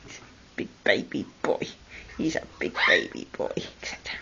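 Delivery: fast, soft whisper; whispered pet talk. Real, not acted. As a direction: breathe the words quickly to the bird. Whispering phrases to the parrot.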